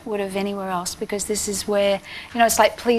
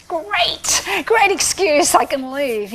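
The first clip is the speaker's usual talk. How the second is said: higher, bright-eyed relief at an escape; pitched up, as if handed a way out; gleeful inner voice; 5 semitones higher, much slower than usual. Retold or imitated. Teen singer recalling her thought on leaving school, pitched-up and gleeful. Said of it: "It was like".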